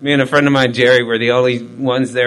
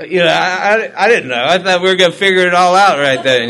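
laughing through it, louder and higher; real amused laughter at himself; chuckling while he admits it; 6 semitones higher, 6 dB louder than usual. Real, not acted. Laughing at his own naivety.

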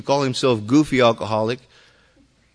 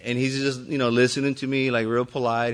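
ordinary talk, nothing marked

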